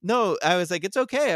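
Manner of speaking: put-on reliving soothing the crying girl; retold tearful reassurance. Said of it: "I started crying".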